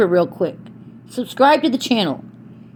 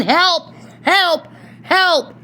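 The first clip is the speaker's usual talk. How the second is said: mock yell for help, pitched up; retold yell for help; 5 semitones higher, much slower than usual. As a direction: imitate yourself calling out for help, only half shouted. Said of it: "I was yelling".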